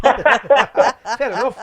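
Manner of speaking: slow words drawn out through a laugh. Real, not acted. Laughing along at his mate's football story. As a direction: let the laugh carry it, slowly.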